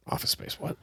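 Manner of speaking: whispered, puzzled question; surprised whisper. Retold or imitated. Owner hearing the news in the roasting room, whispered surprise. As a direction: hiss it to yourself, puzzled. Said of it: "And I was like".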